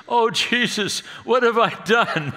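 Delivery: high, loud, half-laughing cry of the wife; mock a panicked bride with a laugh in it; retold wife's high, dismayed wail; horrified new wife. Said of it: "And she says".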